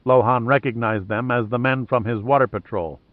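plain reading voice

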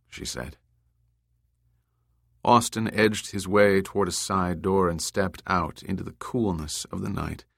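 ordinary delivery

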